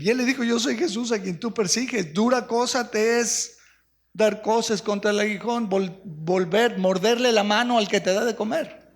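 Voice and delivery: preaching. Acted. Pastor tells the congregation Jesus' words, preaching. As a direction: proclaim it.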